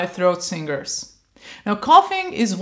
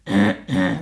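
low small throat-clearing; light cough; 12 semitones lower than usual. Shown as a demonstration. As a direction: cough lightly, not harsh. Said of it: "don't go harsh".

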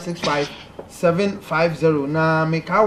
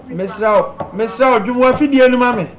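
louder and higher, calling down a bad phone line; 7 semitones higher, 6 dB louder than usual. Real, not acted. Host calling out to a caller on a bad line, loud. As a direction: raise the voice so the caller can hear.

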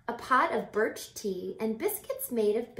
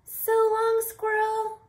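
much higher, louder chirpy farewell; cheery goodbye voice; 13 semitones higher, 7 dB louder than usual. Acted. A child character waves off Squirrel, high cheery farewell. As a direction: call out a bright goodbye.

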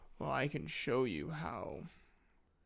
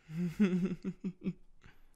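warm little laugh; 5 semitones higher, 5 dB louder than usual. Acted. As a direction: laugh fondly. Reacts to partner's tickle reaction.

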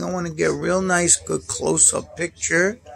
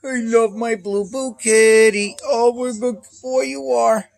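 high, louder pet praise; 7 semitones higher, 5 dB louder than usual. Real, not acted. High loud doting coo. Cooing over cat.

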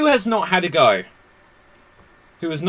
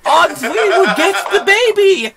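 very high, frantic mock voice; high, over-the-top villain's sidekick; hammed-up film henchman; 7 dB louder than usual. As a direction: squeal it in melodramatic alarm. Acts character shrieking about painting.

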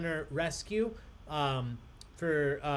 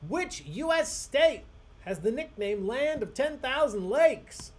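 higher, louder game-show read, slow; quiz host announcing; 9 semitones higher, 7 dB louder, slower than usual. Acted.

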